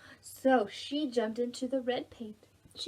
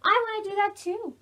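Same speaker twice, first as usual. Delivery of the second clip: mouse voice; 10 semitones higher, 5 dB louder than usual. Acted. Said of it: "said the yellow mouse".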